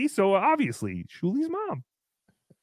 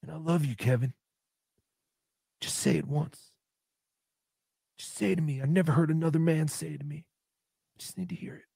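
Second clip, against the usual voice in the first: mock tender plea; 3 semitones lower, 6 dB quieter than usual. Mock sad plea to Kevin.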